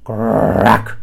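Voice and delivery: loud beast growl; growled crack. A narrator's reading. Beast growling at the bird. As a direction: growl it out like a grumpy monster. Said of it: "he growled".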